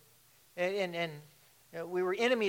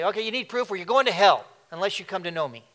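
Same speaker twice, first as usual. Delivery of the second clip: loud, fast hammed-up threat; mock hellfire scolding, louder and fast; 3 semitones higher, 11 dB louder, faster than usual. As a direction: bark it like a harsh preacher. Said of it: "He didn't come and say".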